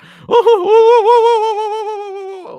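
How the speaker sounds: long, loud, very high "ooh"; hammed-up high-pitched howl, held long; mock wail. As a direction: wail in exaggerated mock distress. Mocks reaction when Yankee has belt.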